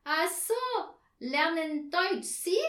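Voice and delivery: loud, high foreign learner; delighted realisation. A narrator's reading. Herr Marconi finally understanding the lesson, delighted and loud. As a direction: burst out in broken German, high and loud. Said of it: "Er lachte".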